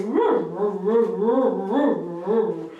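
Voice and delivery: loud run of howling roos; drawn-out, wavering dog-bark impression; imitating dog's bark. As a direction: mimic the dog's woofing howl. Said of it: "I know it's you when I hear you".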